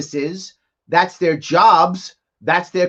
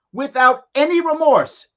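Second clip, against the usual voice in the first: ordinary talk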